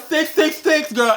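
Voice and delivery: very high, loud panicked cry; panicked squeal. Retold or imitated. Squealing six six six to her sister. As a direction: shriek the numbers. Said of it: "I was like".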